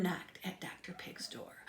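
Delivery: spooky whispered narration. A narrator's reading. Narrator building Halloween suspense, hushed whisper. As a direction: hush it for the spooky knock.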